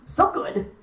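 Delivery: a delighted little chuckle, then savouring it; happy elf chuckling over food; gleeful chuckle. Acted. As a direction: laugh over the last tasty bite. Nissen savours last bite.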